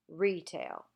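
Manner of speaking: RE-tail, falling off after RE; retail, stressed naturally at the front; stress on first syllable. Shown as a demonstration. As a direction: say retail with weight on RE. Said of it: "you naturally stress the first syllable".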